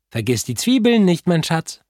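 high, sing-song motherly voice; fluting mother. A narrator's reading. Mother reminding her son about the onions, high fluting. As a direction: trill it sweetly like a doting mum. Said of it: "flötete Frau Pinkowski".

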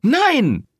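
high, loud refusal; shouted no. Acted. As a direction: slam the door on it. Rosetta refuses outright. (laut geschrien)

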